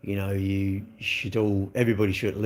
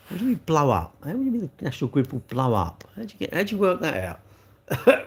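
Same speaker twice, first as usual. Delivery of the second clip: high disbelieving question; incredulous; 5 semitones higher than usual. Real, not acted. Incredulous rebuttal of claim.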